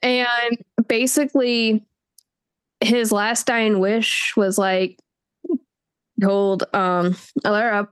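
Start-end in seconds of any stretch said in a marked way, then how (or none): none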